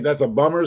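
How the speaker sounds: BUMMER with an American r ending; American pronunciation. Shown as a demonstration. Likening the name to an everyday phrase, American BUMMER. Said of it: "like we say in America".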